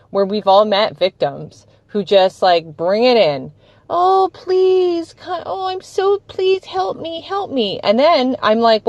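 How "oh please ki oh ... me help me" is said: high, whiny begging voice; mock needy plea. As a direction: imitate a helpless victim pleading. Imitating clingy victims asking help.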